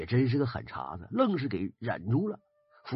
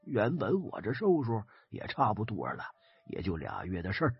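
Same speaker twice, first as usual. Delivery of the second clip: put-on low tired voice of a very old man; aged old man; 4 semitones lower, 4 dB quieter than usual. A narrator's reading. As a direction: sigh it out like a ninety-year-old accepting death. Old man of ninety resigns himself.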